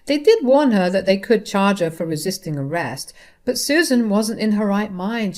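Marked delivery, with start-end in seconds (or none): none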